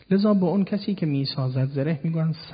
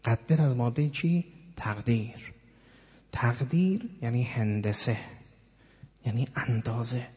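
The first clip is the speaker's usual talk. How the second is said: usual speaking voice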